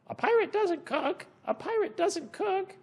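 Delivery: high parrot squawk. Acted. Parrot echoing the answer, high squawk. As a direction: echo it like a parrot.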